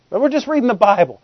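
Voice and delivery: normal talking voice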